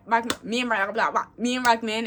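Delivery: ordinary talk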